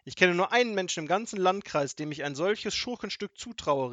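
high, loud outrage; indignant. A narrator's reading. Grandmother accuses the robber of villainy, indignant and loud. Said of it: "Großmutter war empört".

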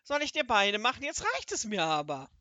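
scolding. Acted. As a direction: snap that he's had enough. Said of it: "schimpfte der große Zauberer".

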